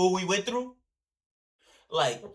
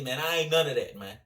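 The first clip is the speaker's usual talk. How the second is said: normal talking voice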